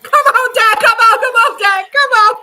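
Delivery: loud, high, pleading shouts; urgent yelling. Real, not acted. Fan urging Dak on the final drive, loud high yell. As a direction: scream the players on.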